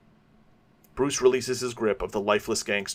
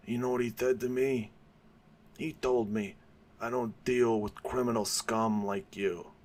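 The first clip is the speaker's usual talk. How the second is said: put-on old mob boss; 6 dB quieter than usual. Old gangster boasting to Batman, lightly voiced. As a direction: ease into an old mobster's confession. Carmine's confession continued.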